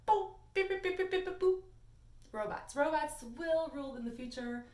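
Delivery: high, loud robot chant of bo bi bi boo. Shown as a demonstration. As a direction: sing it out like a beeping robot. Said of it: "I have to say it like a robot".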